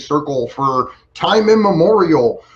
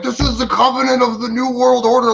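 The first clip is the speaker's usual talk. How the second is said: mock doom announcer; 7 semitones higher than usual. Joking with Ali about the ruling, higher mock-ominous proclamation. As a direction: ham it up like a conspiracy trailer. Mock dramatic line between friends.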